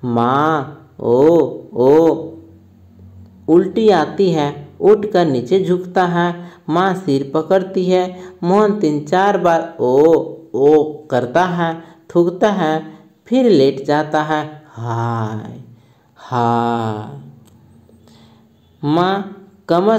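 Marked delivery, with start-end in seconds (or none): 0.1-2.3 low, aching groans for mother; groaning. Acted. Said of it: "Mohan कराहते हुए बोलता है". 14.7-17.2 low, soft, drawn-out moans of pain; very slow, softer aching moan. Acted.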